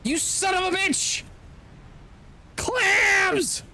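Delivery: high, cursing outburst; exasperated yell. Real, not acted. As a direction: shout it at the screen. Frustrated gamer yelling at game.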